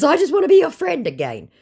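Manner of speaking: mock the scammer begging; imitating a whiny scammer; whiny mock plea.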